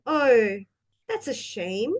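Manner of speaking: concerned, engaged reaction; interested. Shown as a demonstration. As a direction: show you care about their bad news. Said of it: "the way we say the words".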